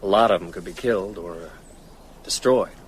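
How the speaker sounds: ordinary delivery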